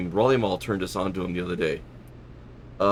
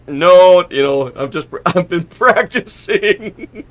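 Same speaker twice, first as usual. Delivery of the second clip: laughing retort; 10 semitones higher, 16 dB louder, slower than usual. Retold or imitated. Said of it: "I'm like".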